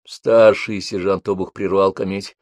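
plain reading voice

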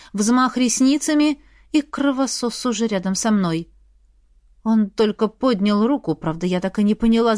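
plain reading voice

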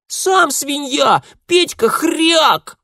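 slow, very high taunting yell; yelling child. A narrator's reading. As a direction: bawl the insult like a little girl. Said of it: "вопила девчонка".

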